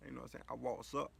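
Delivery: ordinary talk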